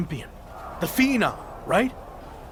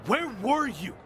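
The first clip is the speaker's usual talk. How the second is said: raised, pitched-up angry questions; angry confrontation; 5 semitones higher, 5 dB quieter than usual. Acted. Betrayed champion confronting his teammates, angry and high. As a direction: demand answers, higher and heated.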